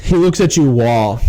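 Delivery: ordinary delivery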